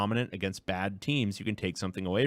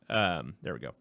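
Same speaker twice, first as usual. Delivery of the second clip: usual speaking voice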